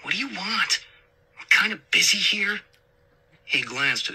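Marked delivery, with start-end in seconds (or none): 0.0-2.6 higher, tense and impatient. A narrator's reading. Safecracker to his mom on the phone, impatient. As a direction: push her off the line, pitched up. Son brushing off mom mid-job.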